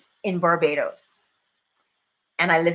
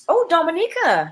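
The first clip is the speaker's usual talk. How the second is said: high, louder exclamation of recognition; pleasantly surprised; 9 semitones higher, 5 dB louder than usual. Real, not acted. Speaker reacting to mention of Dominica, pleasantly surprised. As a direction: break off with a sudden, high-pitched "oh".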